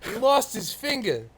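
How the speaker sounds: put-on a half-hearted Boston accent, slow; hammed-up Boston local, lightly done; mock Boston local brag in a weak Boston accent; bad Boston accent. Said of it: "terrible Boston accent".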